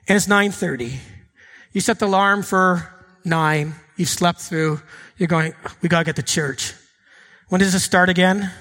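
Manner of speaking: low, sleepy, reluctant mutter; a tired, grudging Sunday morning voice; groggy churchgoer. Pretend. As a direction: play the sleepy late riser dragging to church. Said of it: "maybe some of your mornings might be something like this".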